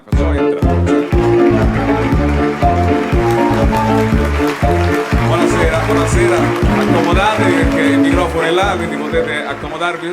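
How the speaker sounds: loud, stretched showman announcement; announcer voice. Acted. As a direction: call the guest on stage like a host. Presenter welcomes guests with music. (voce da presentatore)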